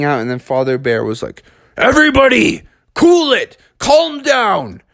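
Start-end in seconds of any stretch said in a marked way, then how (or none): 1.8-4.8 high, emphatic calls for order; exasperated dad calling out. A narrator's reading. Father Bear quieting his panicking kids, high emphatic calls. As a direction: cut across the din in short bursts.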